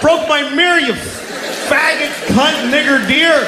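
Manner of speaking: high-pitched ranting yell, full of spite; re-enacted furious tirade, strained and high; yelling into woods. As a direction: hurl curses at a dead enemy. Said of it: "I yelled into the woods".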